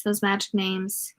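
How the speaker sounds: usual speaking voice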